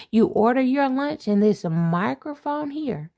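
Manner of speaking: ordinary talk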